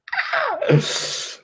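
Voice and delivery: very high squeaky gasp; thin high intake of breath; stunned gasp. Real, not acted. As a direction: gasp in delighted disbelief. Still stunned by famous follower.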